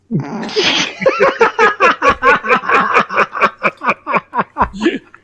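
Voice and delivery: long burst of loud laughing; loud laughter. Real, not acted. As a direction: crack up loudly. Laughs at killed story character.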